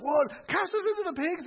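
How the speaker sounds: high-pitched incredulous pig voice; hammed-up high voice of alarmed pigs; startled pigs. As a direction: squeak it in comic disbelief. Voices the startled pigs' reaction.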